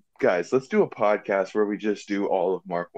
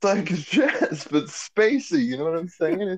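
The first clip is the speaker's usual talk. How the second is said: high mock voice; 9 semitones higher than usual. Mocking spacey jazz explanation.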